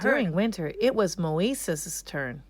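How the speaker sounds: plain reading voice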